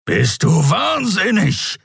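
high, drawn-out indignant growl. A narrator's reading. Skria answering the struggling Andriel, growling. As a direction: growl it in disbelief. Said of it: "knurrte der Skria".

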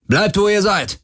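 high-pitched shouted order; barked command. A narrator's reading. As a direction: yell it as a threat. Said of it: "brüllte einer von ihnen". (gebrüllter Befehl)

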